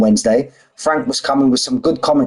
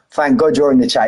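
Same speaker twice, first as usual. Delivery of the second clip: ordinary delivery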